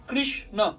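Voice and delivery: "কৃষ্ণ" split into krish and na; syllable-by-syllable demo. Shown as a demonstration. Preacher counting syllables for listeners, "কৃষ্ণ" broken in two. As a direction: say "কৃষ্ণ" with a gap after krish. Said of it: "বা মাত্রা syllable বলতে হবে".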